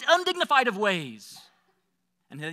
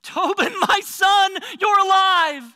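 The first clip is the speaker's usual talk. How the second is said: high, loud, overwhelmed shout; crying out; 11 semitones higher, 9 dB louder, slower than usual. Acted. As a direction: cry out to a child thought lost. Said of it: "He cried out".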